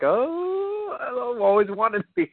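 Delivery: high, swooning wishful voice; high mock longing. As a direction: gush over them in a high voice. Imitating himself seeing Jordans.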